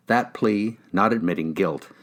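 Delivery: usual speaking voice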